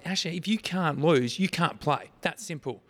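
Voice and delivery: firm parent losing patience; stern scolding. Retold or imitated. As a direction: lay down the law to a child, re-enacted. Acted scolding of crying daughter.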